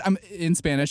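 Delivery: ordinary talk, nothing marked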